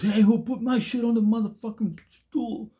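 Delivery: high, loud furious challenge; bully yelling at the cellmates, imitated angry shout; angry demand. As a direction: confront the room, pitched far up. Bully demands who moved his stuff.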